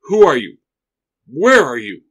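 loud, alarmed demands into the room; guard calling out in alarm; startled call. Acted. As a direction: call out, searching for the voice.

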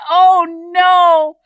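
loud, high mock-dismayed reaction; retold dismay. Newcomer reacting to neighbor's request, mock dismay.

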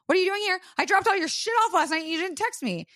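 put-on tearful girlfriend throws his question back, shrill and fast; incredulous shriek. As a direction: toss the question back, high and outraged. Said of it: "And I'm like".

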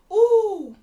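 high, loud, drawn-out ooh; delighted ooh. Real, not acted. Tasting the kiwi drink, delighted. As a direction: whoop at a tasty surprise.

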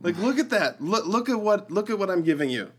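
high, eager acted appeal; eager showing off. Jesus offering a gift to the Father, eager showing off. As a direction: proudly draw attention to your gift.

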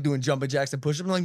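ordinary talk

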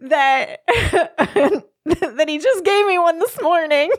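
high, mortified apology breaking into laughter; giggly, high-pitched mock mortification; embarrassed apology. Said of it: "so embarrassed".

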